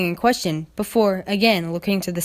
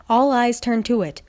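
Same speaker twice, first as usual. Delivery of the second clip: plain reading voice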